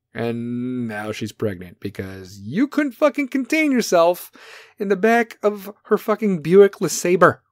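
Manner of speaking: ordinary delivery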